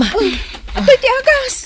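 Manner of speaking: high, loud cry of here it is; high frantic call; breathless relief. Acted. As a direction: thrust the medicine forward, frantic. Bringing medicine.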